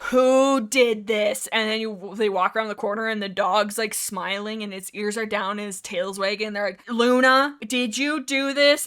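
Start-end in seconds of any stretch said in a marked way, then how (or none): 0.0-1.5 loud, drawn-out pretend scolding; slow, playful telling-off; mock-stern dog owner. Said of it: "They're not actually mad". 6.9-8.9 raised, drawn-out pretend scolding; slow playful scolding; mock-stern dog owner. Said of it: "They're not actually mad".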